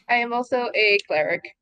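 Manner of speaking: ordinary talk, nothing marked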